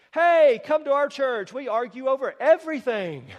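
hammed-up welcome from a bickering church; mock cheery invite. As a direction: sell the grumbling church with fake cheer.

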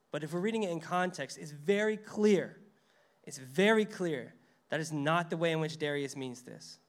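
usual speaking voice

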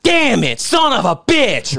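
high, slow mock outburst; mock curse. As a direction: spit out a hammed-up curse. Retold outburst over broken bong.

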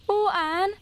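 high, slow, frightened plea; nervous pleading. A narrator's reading. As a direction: beg her to hurry, scared. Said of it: "implored Diana nervously".

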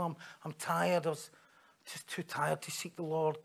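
soft, tired mumble; quiet, listless admission; weary excuse. Retold or imitated. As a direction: give a feeble reason for not bothering.

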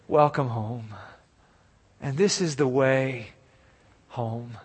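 low, soft, with long pauses; tender welcome. Acted. Father welcoming his returning son home, tender and hushed. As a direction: drop to a hushed, gentle welcome.

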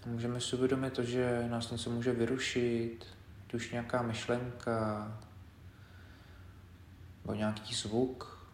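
quiet, unhurried meditation leader; guided meditation. Acted. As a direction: guide them gently through the body, leaving space between phrases. Leads a body scan meditation.

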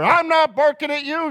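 high-pitched retort, hammed up a little; mock defensive protest. Pastor retelling his reply to the cowboy, mock indignant.

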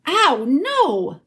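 high pained protest; bellowing. A narrator's reading. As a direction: bellow it without much added volume. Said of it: "bellowed Trumpkin".

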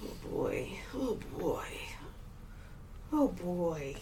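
breathy, hushed drawn-out sigh; soft breathy Coolidge voice; Jennifer Coolidge impression. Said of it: "I'm trying to sound like Jennifer Coolidge now".

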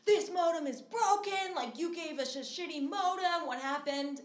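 high, loud complaint down the phone; angry yell. Retold or imitated. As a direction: yell at the internet company. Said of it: "screaming at Spectrum".

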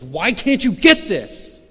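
loud, high, drawn-out exasperated cry; frustrated outburst. Retold or imitated. Nurse to a struggling patient, frustrated. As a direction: snap in loud frustration, stretching the words. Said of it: "she's just frustrated".

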